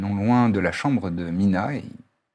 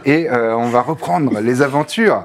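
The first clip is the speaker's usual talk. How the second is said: upbeat host welcome, raised and louder; show intro; 4 semitones higher, 5 dB louder than usual. Acted. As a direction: open the show like a tavern host.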